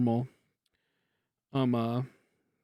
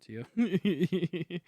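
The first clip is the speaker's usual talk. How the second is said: laughing; 4 semitones higher, 3 dB louder than usual. Real, not acted. Laughing at own joke.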